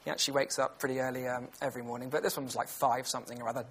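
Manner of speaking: ordinary talk, nothing marked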